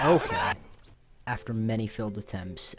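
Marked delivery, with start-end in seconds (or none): 0.0-0.5 high, loud startled okay; surprised. Real, not acted. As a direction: blurt it out startled. Sudden surprise.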